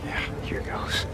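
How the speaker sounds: whispered aside before stepping up. Acted. About to give a speech, whispering to his brother. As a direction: breathe it under your breath, nervous.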